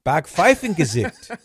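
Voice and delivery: Backpfeifengesicht loud, slow, high in a hammed-up German accent; faux German accent. Shown as a demonstration. Host declaring Netflix's new German name, faux German accent. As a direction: pronounce Backpfeifengesicht grandly in mock German. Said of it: "should be pronounced".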